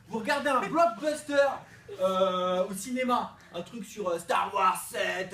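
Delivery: ordinary talk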